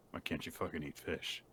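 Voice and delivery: very quiet, low-key imitated disbelief; hushed mock exasperation; muted exasperation. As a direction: say it under your breath as a deadpan retort. Voices the group's exasperated reply.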